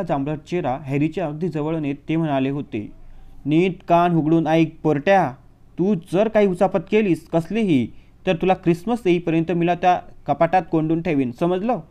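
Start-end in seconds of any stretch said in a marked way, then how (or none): 3.4-11.8 threatening uncle. Acted. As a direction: spell out the threat, hammering it home. (सावकाश, खूप मोठ्याने दिलेली धमकी)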